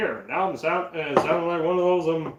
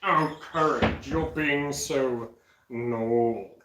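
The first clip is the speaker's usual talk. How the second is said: low, slow, drawn-out menace; creepy cartoon villain; 6 semitones lower, slower than usual. Scolding Courage for being naughty, creepy low cartoon voice.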